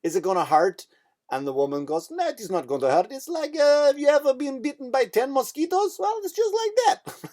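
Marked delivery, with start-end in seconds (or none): 0.0-0.9 imitated nervous boy, pitched higher; nearly crying child. Little fella asks before the needle, imitated as nearly crying. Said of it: "Little fella crying nearly". 2.1-7.3 high-pitched Russian accent impression; mock Russian nurse with no bedside manner, climbing very high. Russian woman taking blood answers the boy, mock Russian accent. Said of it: "here's what I noticed about the Russian accent".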